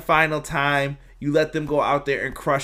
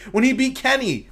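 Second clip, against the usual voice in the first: normal talking voice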